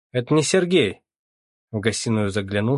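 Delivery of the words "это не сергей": loud, high retort; shouted correction. A narrator's reading. Captain answering the startled mother, loudly correcting her. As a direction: call it out sharply, raised.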